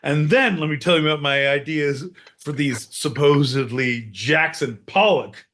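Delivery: usual speaking voice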